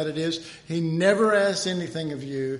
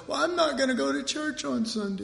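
sulky churchgoer; 7 semitones higher than usual. Retold or imitated. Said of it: "they'll go".

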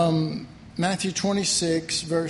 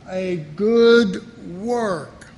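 A good work said very slowly and louder, good hit hardest; repeated emphasis; 7 dB louder, much slower than usual. Shown as a demonstration. Pastor repeating the key phrase to the congregation, slow and firm. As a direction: hammer home good work. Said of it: "when I emphasize something".

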